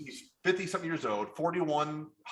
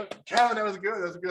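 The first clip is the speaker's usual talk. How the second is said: impressed praise; 7 semitones higher, 4 dB louder, faster than usual. Retold or imitated. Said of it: "Kathy was like".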